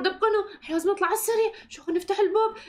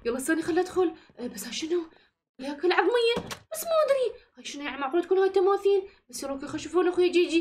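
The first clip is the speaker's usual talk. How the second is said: ordinary delivery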